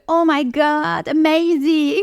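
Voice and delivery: high, slow, gushing "Oh my God"; gushing American. Retold or imitated. Mimicking locals gushing over everything, high and slow. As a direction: ham up an over-excited local. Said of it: "c'est beaucoup le".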